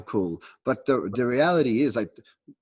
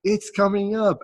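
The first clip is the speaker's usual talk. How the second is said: excited; 9 semitones higher, 4 dB louder than usual. Retold or imitated. Same reliving inner thought.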